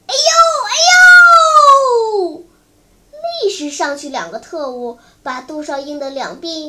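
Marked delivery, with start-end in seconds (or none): 0.1-2.4 pained wailing. A narrator's reading. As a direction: howl "ouch" as if badly hurt. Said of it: "呲牙咧嘴的乱叫". (疼得乱叫)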